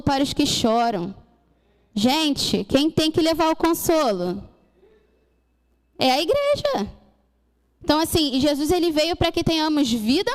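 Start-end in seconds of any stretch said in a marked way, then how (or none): 6.0-6.9 high exclaimed answer. Real, not acted. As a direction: leap up high on "É a igreja". Preacher answers her own question.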